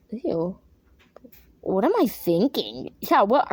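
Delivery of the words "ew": ordinary talk, nothing marked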